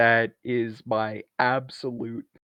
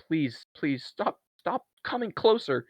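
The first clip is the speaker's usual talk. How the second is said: high, rising panicky plea; retold begging, pitched up toward the end; mock pleading; 6 semitones higher than usual. Jordan backing up the stairs, mock pleading.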